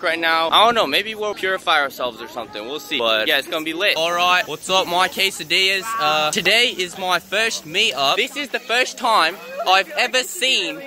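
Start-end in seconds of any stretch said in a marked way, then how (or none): 4.0-5.8 put-on Australian accent, higher pitched; playful Aussie-accent greeting. Shown as a demonstration. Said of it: "I want you to rate my Aussie accent". 6.0-10.8 high, slow put-on Australian accent; Aussie accent. Shown as a demonstration. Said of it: "I want you to rate my Aussie accent".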